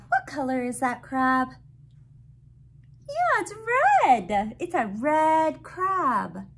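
bright, sing-song picture book reading; reading to a toddler. Acted. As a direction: read it out like to a little one.

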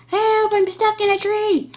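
panicked victim. Pretend. Victim calling out.

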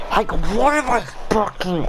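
high, strained burst of frustration; real exasperated outburst; angry at himself. Real, not acted. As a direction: blurt it out way up high, furious with yourself. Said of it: "I'm getting so angry at myself".

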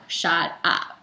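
ordinary talk

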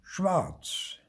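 high little ghost voice; piping one-word answer; Marty. A narrator's reading. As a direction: pronounce the verdict in a high voice. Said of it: "sagte Marty".

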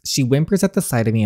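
normal talking voice

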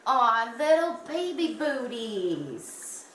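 slow, drawn-out adoring coo; tender cooing. Real, not acted. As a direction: melt over something tiny and cute. Cooing over tiny gifted booties.

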